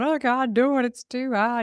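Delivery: high, whiny mock trainee; flustered trainee. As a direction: sound like a struggling junior giving up.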